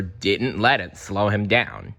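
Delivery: usual speaking voice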